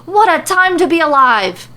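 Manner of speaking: hammed-up giddy shopkeeper; gleeful exclamation. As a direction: revel in the moment.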